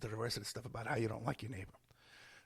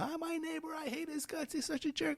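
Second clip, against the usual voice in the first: whiny complainer; 4 dB quieter than usual. Retold or imitated. Acting a caller complaining to cop.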